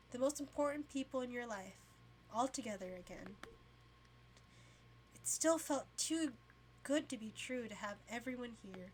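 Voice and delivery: ordinary delivery, nothing marked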